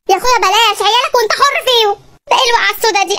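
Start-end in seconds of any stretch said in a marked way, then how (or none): none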